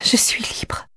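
soft trembling whisper. Acted. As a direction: barely breathe the words. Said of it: "murmure encore la voix tremblante".

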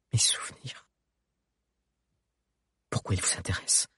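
hissed angry whisper; whispered fury. A narrator's reading. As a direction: hiss it through clenched teeth. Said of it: "Elle siffla entre ses dents".

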